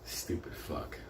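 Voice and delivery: whispered under the breath; muttered insult. Real, not acted. About the bank teller, a whispered insult. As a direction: hiss it under your breath.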